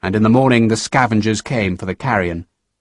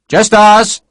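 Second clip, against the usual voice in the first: higher, louder curt reply; Sharp answering curtly; 9 semitones higher, 6 dB louder than usual. A narrator's reading. Sharp curtly answers the lieutenant, louder. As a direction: cut it short.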